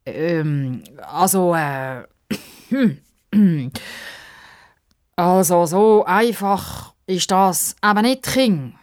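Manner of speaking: hesitant, stalling with ums and throat-clearing; embarrassed old man. A narrator's reading. Old man stalling the child's question, embarrassed and hesitant. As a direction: hem and haw awkwardly before answering. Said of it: "Der alte Mann hüstelt verlegen".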